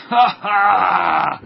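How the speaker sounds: loud, high villain laugh shouting ha ha; loud evil laugh. Acted. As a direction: gloat out loud like a cartoon germ. Germ gloats over Willy.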